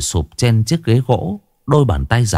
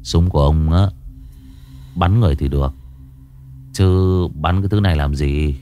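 laconic tough guy; 6 semitones lower than usual. Acted. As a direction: toss it off coolly. Cool young man, offhand.

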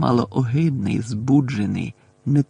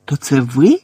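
loud, shocked question; stunned question; gasping disbelief; 7 dB louder than usual. A narrator's reading. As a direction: ask in near-choking disbelief. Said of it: "трохи не задихнувся Гаррі".

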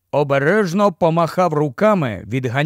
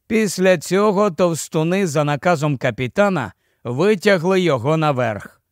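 ordinary delivery

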